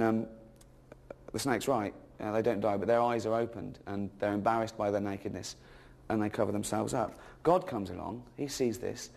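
ordinary delivery

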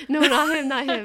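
laughing no, not him. Real, not acted. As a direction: laugh through the denial. Laughing denial.

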